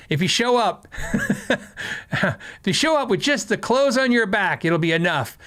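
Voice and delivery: quoting the recruiters while laughing, pitch up; laughing through quote. Retold or imitated. To viewers, retelling the recruiters' promise, laughing. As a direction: chuckle through the army's promise.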